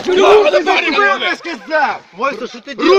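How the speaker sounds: loud, drawn-out demand; angry shout. Real, not acted. Man yells at Vasya in a street scuffle, angry shout. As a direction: bark it at him.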